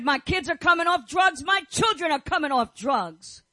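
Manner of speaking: put-on a believer crying out that the children are coming off drugs; shouted declaration. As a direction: proclaim it at full voice over the congregation.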